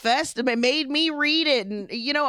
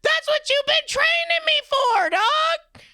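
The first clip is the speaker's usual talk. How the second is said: retold outraged shout; 14 semitones higher, slower than usual. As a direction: yell at your dad like he betrayed you. Retelling yelling at his dad.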